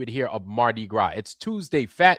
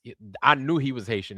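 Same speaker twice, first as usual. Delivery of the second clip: usual speaking voice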